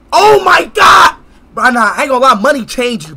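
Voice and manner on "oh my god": loud, drawn-out exclamation; higher, louder, stretched-out exasperation; exasperated outburst. Acted. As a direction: cry it out in slow disbelief. Exasperated at friend denying his name.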